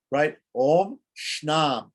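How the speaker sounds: normal talking voice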